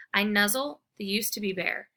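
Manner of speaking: usual speaking voice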